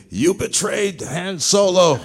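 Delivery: put-on high-pitched, drawn-out child's accusation; accusing little kid. Actor mimics daughter's friends confronting him in the schoolyard, accusing child voice. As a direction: imitate an indignant child, stretching each word up high. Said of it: "her little friends run up to me and say".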